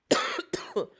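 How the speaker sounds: harsh sick cough. Real, not acted. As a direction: cough hard mid-sentence. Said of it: "I am, like, sick".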